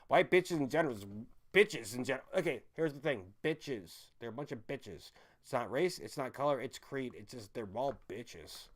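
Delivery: ordinary talk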